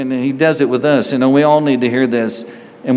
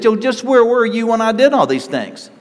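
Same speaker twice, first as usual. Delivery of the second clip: much higher, ringing rebuke; God rebuking Job; 10 semitones higher than usual. Retold or imitated. Preacher voicing God challenging Job, raised high. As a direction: thunder the question down at Job.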